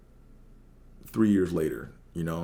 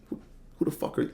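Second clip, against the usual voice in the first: fast, breathy contemptuous whisper of who the fuck are you; whispered attitude; 5 dB quieter, faster than usual. Retold or imitated. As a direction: spit it out quick under your breath. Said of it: "you get the attitude with it".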